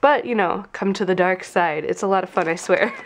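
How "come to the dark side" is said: ordinary talk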